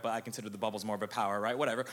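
words pushed out on a burp. Real, not acted. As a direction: talk through a burp. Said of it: "excuse me, that was a burp".

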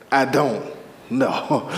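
low, halting, deliberate answer; unbothered reply. Acted. Reply to someone pressuring you, low and unbothered. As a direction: answer low, pausing before the last word.

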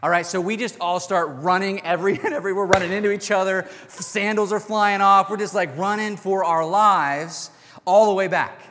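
excited retelling. Retold or imitated. Kids fleeing in panic.

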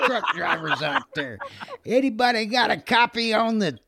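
high, loud hammed-up trucker radio call, laughing through it; mock CB trucker. Keying the walkie to prank the marshal, loud mock CB trucker call. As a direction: bellow it like a goofy CB trucker hailing the road.

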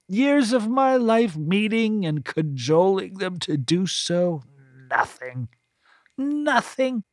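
put-on high, disdainful old woman voice; a scornful, self-pitying matron; bitter voluptuary. A narrator's reading. Voluptuary character voice.

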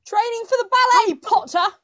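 loud, high mock taunt; Draco Malfoy. Player turns a quiz quote into villain's taunt, loud impression. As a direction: throw it out as a sneering schoolboy rival. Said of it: "I can do such a good Draco impression".

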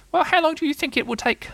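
high little-creature voice; impatient Roo. Acted. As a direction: ask in a tiny, piping voice. Roo's put-on voice.

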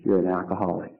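soft, low and gentle, a quiet revelation; tender inner voice speaking softly. Retold or imitated. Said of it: "that gentle voice say".

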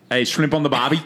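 schoolboy joke in a bad Australian accent; mock Australian accent. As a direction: toss out a silly Australian catchphrase across the classroom. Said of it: "we're just like".

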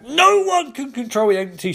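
high, loud urgent scream; screamed warning. Acted. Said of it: "screams Ganthet".

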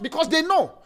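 loud, high, driving sermon delivery; preaching at full force. Acted. Pastor urging the congregation to fruitfulness, preaching loudly. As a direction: preach it hard to the church.

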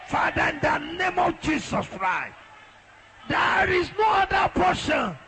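high-pitched, urgent prayer call; fervent prayer leading. Real, not acted. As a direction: lead the congregation in prayer, stretching the final words. Leads prayer, high forceful.

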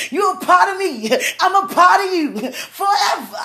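loud and high with joy, laughing; can't hold the joy in; overjoyed. Real, not acted. Bursting with joy about new love.

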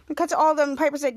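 ordinary delivery, nothing marked

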